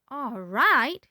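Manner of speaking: put-on shrill outraged yell of a cranky old grandma. A narrator's reading. Old grandma yelling at her grandson. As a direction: shriek the question back in disbelief. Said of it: "she yelled".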